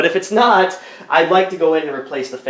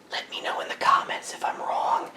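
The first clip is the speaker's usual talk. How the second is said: whispering; 12 dB quieter than usual. Acted. Aside to viewers after a request.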